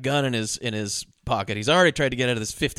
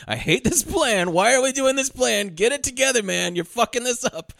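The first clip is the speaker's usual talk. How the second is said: imitating a fed-up partner, higher; exasperated partner; 7 semitones higher than usual. Man mocking his boss, higher and exasperated. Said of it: "shaking his head and, like".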